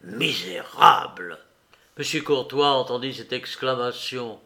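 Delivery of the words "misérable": low, loud and slow, hoarse with rage; a judge's cold fury in one hoarse word; hoarse single word. A narrator's reading. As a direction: spit it out in a raw, hoarse voice. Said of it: "d'une voix rauque ce seul mot".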